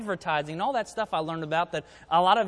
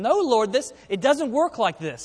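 raised, slightly louder imagined objection; Peter's protest; 5 semitones higher, 5 dB louder than usual. Acted. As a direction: act out a mild, higher-pitched protest. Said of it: "I can just imagine Peter saying".

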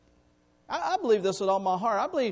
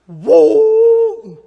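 startled cry; 13 semitones higher, 17 dB louder than usual. Retold or imitated. Startled by the train horn.

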